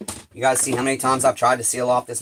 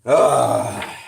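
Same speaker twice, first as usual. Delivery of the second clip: overwhelmed groan; 7 dB louder than usual. Real, not acted. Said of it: "Getting overwhelmed now".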